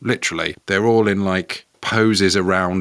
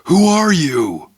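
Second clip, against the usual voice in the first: loud, high, drawn-out criminal's cry; frightened criminal; 10 semitones higher, 6 dB louder, slower than usual. Acted. Criminal confronting Batman, acted loud and high. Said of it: "the criminal's like".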